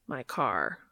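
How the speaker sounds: low, deadpan and deep; deep Cass voice. Acted. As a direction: say it flat and low. Cass's deep voice.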